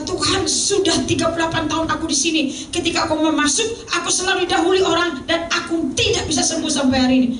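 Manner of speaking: a hint of a desperate invalid; pleading sick man. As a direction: plead your long wait to the Lord. Voices the sick man's complaint.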